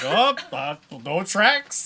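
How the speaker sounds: loud, high mock searcher voice; big hammed-up report of finding nothing; mimicking the searcher. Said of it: "You're looking around like".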